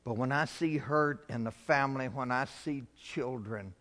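ordinary delivery

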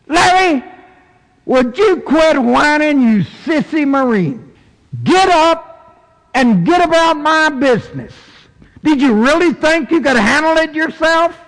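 high, scolding divine rebuke; stern voice of God. Acted. God telling a whining Marine to get up, stern rebuke. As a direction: scold him from on high like a drill order. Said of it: "I heard the stern voice".

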